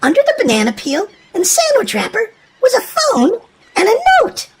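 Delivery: ordinary delivery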